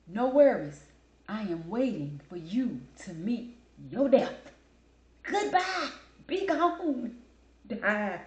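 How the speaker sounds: loud, slow theatrical condemnation; mock death sentence. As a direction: banish the phrase with hammed-up force, pitch leaping up.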